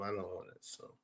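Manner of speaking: nearly silent repeat under the breath; almost mouth it to yourself; barely audible. Real, not acted. Streamer repeating to himself, almost silent mutter.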